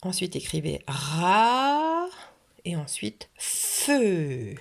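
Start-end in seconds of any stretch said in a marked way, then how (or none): 0.9-2.1 long rising RA; "ra" held long, climbing in pitch; syllable stretched. Shown as a demonstration. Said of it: "Répétez bien chaque syllabe". 3.4-4.5 long FEU with a held "f"; "feu" slowly, both sounds stretched; syllable stretched. Shown as a demonstration. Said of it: "Répétez bien chaque syllabe".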